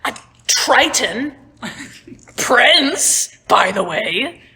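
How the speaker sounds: high, loud, frantic ranting; exasperated rant. Acted. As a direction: rant at him, losing patience. Davin rants at Joe.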